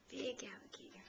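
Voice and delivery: hushed spooky whisper of big alligator; breathy mock-scary whisper. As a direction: whisper it like a secret to a baby.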